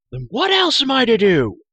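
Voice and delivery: loud, high outburst; desperate cry. A narrator's reading. Trapped hero to his companion, a loud desperate cry. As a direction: cry it out at full voice. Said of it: "cried Taryn".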